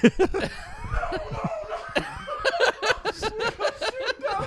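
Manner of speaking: high shrieking laugh; scream laughing. Real, not acted. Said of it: "We're scream laughing".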